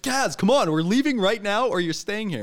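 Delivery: loud, high call to hurry. Retold or imitated. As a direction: urge him out the door, loud. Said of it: "be like".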